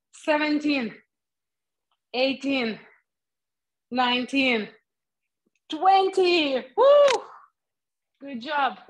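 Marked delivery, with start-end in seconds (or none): 5.7-7.2 rising to a high whoop; excited cheer. Real, not acted. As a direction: cheer the last rep loud and high. Finishing set, cheering.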